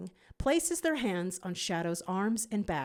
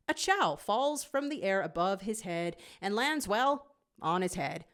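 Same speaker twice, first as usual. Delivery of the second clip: ordinary delivery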